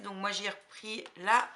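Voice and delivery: ordinary talk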